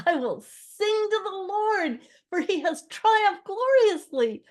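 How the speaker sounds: very high, slow, loud proclamation; voice carrying far. A narrator's reading. Moses praising God over the drowned army, exultant carrying cry. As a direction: cry it out exultantly, stretching the words. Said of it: "Moses' voice carried on the wind".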